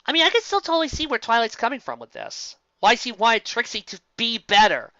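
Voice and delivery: usual speaking voice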